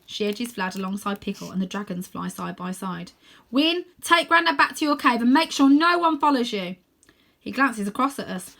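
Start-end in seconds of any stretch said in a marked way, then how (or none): 3.5-6.8 loud, higher orders over the wind; raised command. Acted. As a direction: call the order across to Win. Rose commands across the air.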